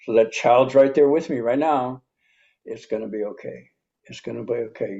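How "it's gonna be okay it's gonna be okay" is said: softer, gentle self-reassurance; soothing reassurance. Shown as a demonstration. Adult soothing his inner child, soft and reassuring. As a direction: soothe the frightened child inside, softly. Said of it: "that's the nurturing I didn't get".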